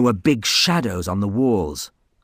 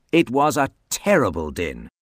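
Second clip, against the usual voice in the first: usual speaking voice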